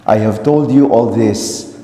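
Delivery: ordinary talk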